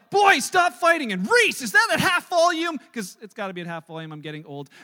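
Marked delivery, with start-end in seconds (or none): 0.1-1.1 put-on high, loud, drawn-out order to the boys; retold yell. Dad breaking up his arguing boys, yelling. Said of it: "I look at the boys and I yell". 1.3-2.8 high, loud parental complaint; retold yell. Dad calling to daughter at the piano, loud exasperated yell. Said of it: "I yell".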